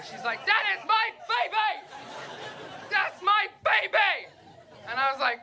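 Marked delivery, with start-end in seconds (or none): 0.5-4.3 high, loud indignant shriek of a mother; mad mother. Retold or imitated. Friend defending her baby, a high loud indignant shriek. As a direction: squeal it like an offended mom, slow on the repeat. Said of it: "my friend got so mad at me".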